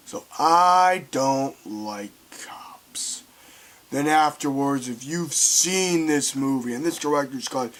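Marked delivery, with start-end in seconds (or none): 0.1-3.2 slow, breathy villain whisper; menacing whisper. Retold or imitated. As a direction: hiss the threat slowly.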